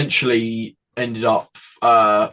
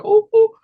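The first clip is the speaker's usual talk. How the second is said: high, loud sudden yelp; imitated vocal tic; 8 dB louder than usual. A boy's tic bursts out while he is crying. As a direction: blurt out a sharp, high tic cry.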